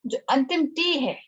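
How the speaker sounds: usual speaking voice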